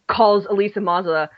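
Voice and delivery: normal talking voice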